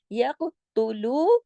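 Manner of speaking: "यक़्तुलू" slowly, lu drawn long; recitation demo. Shown as a demonstration. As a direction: stretch "लू" in "यक़्तुलू". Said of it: "लाम वाव पेश लू".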